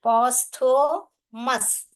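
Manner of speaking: "posthumous" drawn out in separate syllables; slowly spell-along "posthumous", part by part. Shown as a demonstration. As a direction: break "posthumous" into slow, clear syllables. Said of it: "syllable divide करके बताती हूँ".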